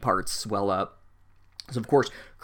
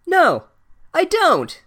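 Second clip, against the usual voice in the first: high, loud indignant denial; comically indignant yell; exasperated outburst; 8 dB louder, much slower than usual. Acted. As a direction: shout it back, fed up and high-pitched. Exasperated answer to the book's assumption.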